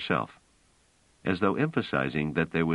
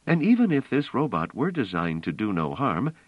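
higher voice, pressing her point; a woman insisting on the plan; Bliss, urgent and intent; 8 semitones higher than usual. Acted. Bliss urging Pellerat to play robots, earnest.

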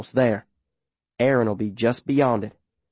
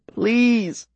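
weeping; 10 semitones higher than usual. Acted. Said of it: "I'm practically weeping it".

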